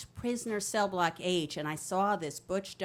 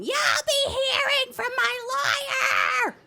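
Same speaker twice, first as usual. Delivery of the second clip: shrill, drawn-out full-volume shriek; very high, loud hammed-up scream; imitated screaming mother; 7 dB louder, slower than usual. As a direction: screech a legal threat like an outraged mother. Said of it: "hear my mother screaming".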